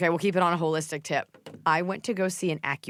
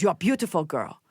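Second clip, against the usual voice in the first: imitating the doctor. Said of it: "I'm imitating her".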